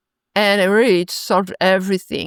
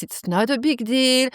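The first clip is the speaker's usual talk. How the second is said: ordinary talk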